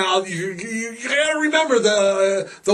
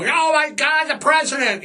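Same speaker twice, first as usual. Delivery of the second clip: mother's cry; 7 semitones higher than usual. Retold or imitated. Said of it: "And Mom".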